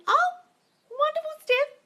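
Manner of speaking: very high-pitched squeal of joy; delighted congratulation. Real, not acted. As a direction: coo with high, excited delight. Delighted at a viewer's happy news.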